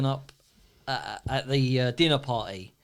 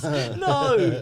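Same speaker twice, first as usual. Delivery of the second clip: higher, slow, indignant voice; mock outraged refusal; 8 semitones higher than usual. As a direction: put on a prim outraged voice. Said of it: "then she comes out and goes".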